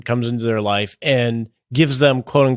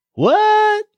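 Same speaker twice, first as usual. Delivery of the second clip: very high-pitched, exaggerated bewilderment; baffled mock reaction. Host shows how lost the dialect left him, mock-baffled. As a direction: squeak it out as if totally lost.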